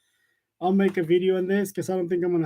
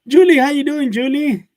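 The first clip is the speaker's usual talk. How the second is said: calling out; 7 semitones higher, 9 dB louder than usual. Real, not acted. Greeting a viewer on livestream.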